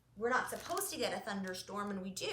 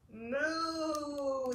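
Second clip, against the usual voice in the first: long, drawn-out moo; cow moo; 2 semitones higher than usual. Acted. Reading Cow's line to kids, long moo. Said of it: "said cow".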